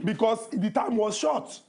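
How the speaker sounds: slow line landing on "short", pitch rising; stress on SHORT. Real, not acted. Answering an annoyed co-host, stressing "short" with a rise. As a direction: draw it out and lift "short" at the end. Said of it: "You emphasizing on the word short".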